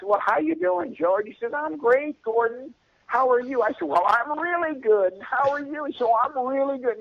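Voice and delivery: high, sing-song mock pleasantries; sugary fake politeness. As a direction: play the overly sweet phone small talk. Mock-cheery phone pleasantries retold.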